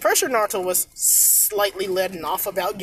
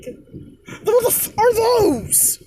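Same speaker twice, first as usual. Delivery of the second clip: much higher, crying out in disbelief; shocked outcry; 12 semitones higher, much slower than usual. Acted. Naruto points at Haku's chest, shrieking in disbelief. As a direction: shriek it like you can't believe your eyes.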